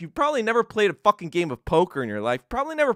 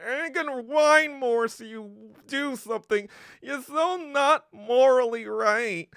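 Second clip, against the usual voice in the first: mock whining; 6 semitones higher than usual. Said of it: "China be like".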